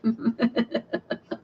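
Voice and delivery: soft, bubbling giggle; quiet giggle to yourself; amused chuckle. Real, not acted. Crafter tickled by her animal scene, amused giggle.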